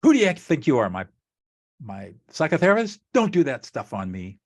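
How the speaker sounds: acted challenge, starting high and loud; indignant pushback. Partner rejecting amateur therapy, acted indignant pushback. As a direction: push back sharply, high then settling. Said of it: "you can get pushback".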